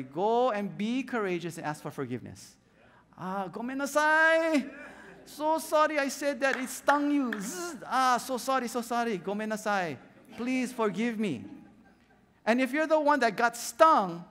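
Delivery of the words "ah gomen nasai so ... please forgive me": higher, hammed-up Japanese-accented apology; bow and apologise in broken Japanese-accented English; an over-the-top apologetic Japanese accent; mock Japanese accent.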